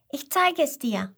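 put-on high-pitched eager child; little koala. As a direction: offer it keenly in a small child's voice. Little koala offers to show her.

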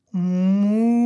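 loud mooing like a cow. Acted. The cow answers.

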